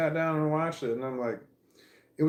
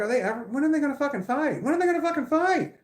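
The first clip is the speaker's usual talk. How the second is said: impatient outburst; 13 semitones higher, faster than usual. Retold or imitated. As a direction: demand the fight at last, fed up. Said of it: "I'm like".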